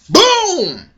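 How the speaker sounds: loud high shouted boom; boom sound effect. Retold or imitated. Reviewer acting out the jutsu blast, loud shouted boom. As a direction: punch out the explosion noise.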